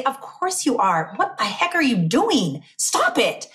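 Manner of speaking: imitating an incredulous champion telling her off; exasperated scolding. Champions setting a self-doubter straight, exasperated scolding. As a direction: scold with mock outrage, drawing out the first words. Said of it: "they wanna slap me and say".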